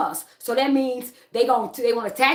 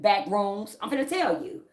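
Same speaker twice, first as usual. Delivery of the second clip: ordinary delivery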